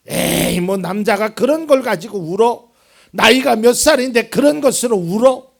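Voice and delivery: loud, sneering taunt; mocking scoff. Preacher voicing the world mocking a crying man, loud scoffing. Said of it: "이게 세상의 사람들의 시선이에요".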